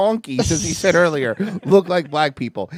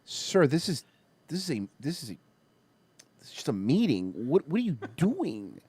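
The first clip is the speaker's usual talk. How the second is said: much softer, confused reply; bewildered quiet; 5 semitones lower, 9 dB quieter than usual. Retold or imitated. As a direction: speak low like a stunned man. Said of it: "the guy's just standing like".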